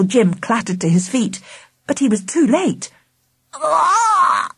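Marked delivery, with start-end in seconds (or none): 3.5-4.5 high, strangled croak; choking cry; croaked gasp. A narrator's reading. Angela pretending to choke, croaked cry. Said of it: "croaked Angela".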